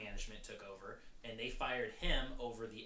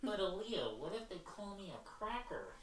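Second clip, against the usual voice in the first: high-pitched put-on objector voice; impersonated objector; 9 semitones higher than usual. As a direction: butt in with a high, whiny-protester impersonation. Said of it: "This is my impersonation of somebody".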